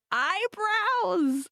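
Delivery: high, slow, amused exclamation; amused surprise. Real, not acted. Spotting the host's eyebrows go up, amused high surprise. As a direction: stretch it out as you catch her frown. Notices listener's puzzled face.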